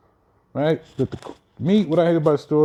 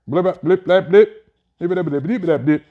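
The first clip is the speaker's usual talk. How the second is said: mock censor bleeps; 4 semitones higher, 4 dB louder than usual. Censoring the word aloud.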